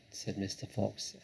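plain reading voice

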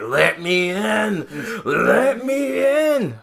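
high, slow begging to be let in; mock pleading. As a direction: whine at the locked door like a kid. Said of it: "I'm just like".

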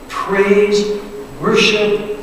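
ordinary talk, nothing marked

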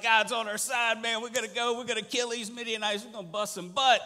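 loud, high rallying cry; hyped-up leader shouting to his men; pumped up. Acted. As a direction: fire up the troops before battle. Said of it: "He's got 'em all pumped up".

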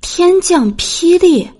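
high, slow incredulous echo; surprised. Acted. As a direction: echo in disbelief. Princess surprised. (惊讶地反问)

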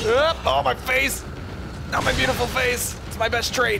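high, loud, hammed-up distress; mock panicked wail. Player mourning his beautiful face, mock panic. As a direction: wail it in comic vanity.

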